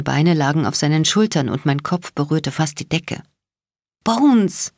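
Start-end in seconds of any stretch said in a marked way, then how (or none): 4.0-4.7 high, strained protest; squeezed out. A narrator's reading. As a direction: squeeze the name out, pitched up. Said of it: "quetschte ich hervor".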